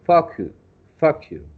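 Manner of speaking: FUCK YOU as focused without its long O; mispronounced focused. Shown as a demonstration. Teacher warning about a lost O, mispronunciation demo. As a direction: demonstrate the slip FUCK YOU with a short vowel. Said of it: "if you don't pronounce that correctly".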